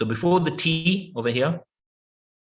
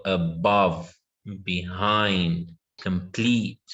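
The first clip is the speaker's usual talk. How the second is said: "above, behind, complete" slow and low, second syllables strong; stress on second syllable; 5 semitones lower, much slower than usual. Shown as a demonstration. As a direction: lean on BOVE, HIND, PLETE in "above, behind, complete". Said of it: "our stress is on the second syllable".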